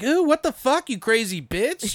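high mock shriek of shock; shocked cry. Retelling a movie stab, high mock shocked yelp. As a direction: play the shock of being stabbed.

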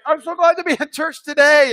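mock cheerful host. Sweaty host doing cheery bit.

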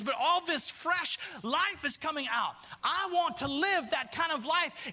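high, ringing, drawn-out preaching; preaching at full force. Acted. Preacher building to a climax about rivers, fervent and high. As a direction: lift the church with rising fervor.

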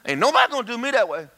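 high-pitched protest, playing defiant; hammed-up stubborn objection; mock indignant retort. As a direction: push back in a high, comic huff. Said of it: "You go".

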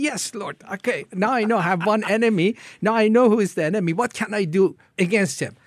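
loud, high, excited reply; eager acceptance. Retold or imitated. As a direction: accept the mission with rising volume. Acted eager reply to God.

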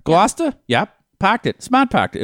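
high, loud mock Boston accent; imitating a broad Boston accent. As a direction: shout it like a Bostonian, dropping the Rs. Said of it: "all those assholes had Boston accents".

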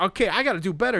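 high, loud, chipper self-consoling; mock upbeat analyst. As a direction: cheer yourself up like a batter who went hitless. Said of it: "He's like".

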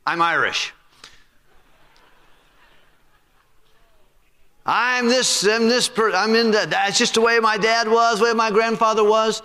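high, whiny mock excuse voice; sing-song the lame excuse people give; mock excuse-maker. Preacher mocking people who blame their heritage, in a high whiny voice. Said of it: "By the way, people say".

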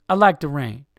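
loud, punched-out mock tough voice; second-try impression landing as a fighter; boxer before a fight. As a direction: say it like a boxer squaring up. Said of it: "That just sounded like a boxer before a fight".

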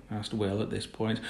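ordinary delivery

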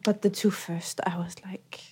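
whisper. Real, not acted. Aside while recounting boredom.

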